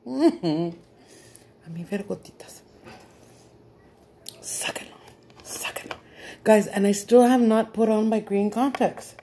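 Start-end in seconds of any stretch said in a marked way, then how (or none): 4.4-5.9 whispered snake hiss. Acted. Storyteller reprising the snake from her joke, whispered hiss. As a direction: hiss it very softly, no voice.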